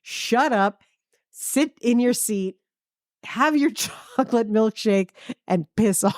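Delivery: a touch of a gruff seventies New York mom; brusque old-school mom. Retold or imitated. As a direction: bark it like a no-nonsense mother. Old-school mother scolding kids.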